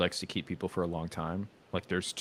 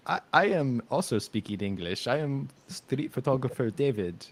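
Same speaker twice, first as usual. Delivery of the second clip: higher-pitched mock Eastern European accent; stilted English in an Eastern European accent; 5 semitones higher than usual. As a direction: imitate an earnest Eastern European photographer. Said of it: "that sounds Eastern European".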